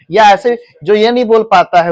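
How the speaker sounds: usual speaking voice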